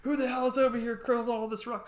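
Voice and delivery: high, loud mock outrage; flipping out. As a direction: imitate someone furious before noticing who it is. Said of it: "the person that was flipping out".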